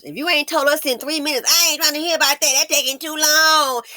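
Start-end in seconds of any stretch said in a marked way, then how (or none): 1.5-3.8 high, fast mimicked whine of impatience; mock impatient viewer, pitched up; impatient complaint. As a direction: imitate someone who won't sit and listen. Mimicking impatient viewers who won't listen.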